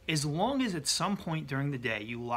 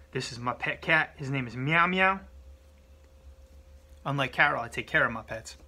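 ordinary delivery

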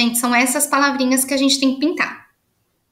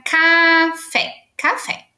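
café said higher, to model the word; clear model word; 5 semitones higher, much slower than usual. Shown as a demonstration. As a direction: say café up high for the class. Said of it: "K A F E".

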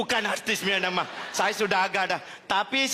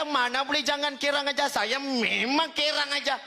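high, insistent protest; 10 semitones higher than usual. Retold or imitated. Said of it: "Saya kata".

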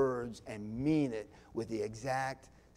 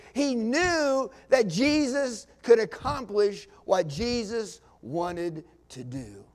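loud and higher, pressing every word; emphatic; 6 semitones higher, 9 dB louder than usual. Shown as a demonstration. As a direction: drive the point home. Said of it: "Can you hear that in his voice?".

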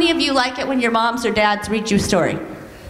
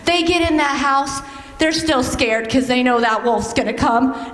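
ordinary delivery

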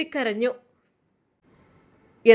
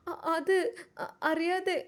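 timid stammer; 4 semitones higher, 7 dB quieter than usual. Acted. Daughter stammers under questioning.

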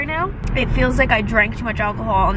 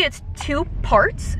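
ordinary talk, nothing marked

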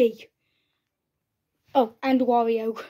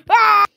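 loud high scream; play-acted shriek; mock scream; 8 semitones higher, 10 dB louder than usual. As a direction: scream as you're thrown in. Captured characters scream.

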